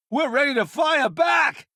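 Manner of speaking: high, eager battle shout. Acted. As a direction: call out ready to fight. Combat call to boss.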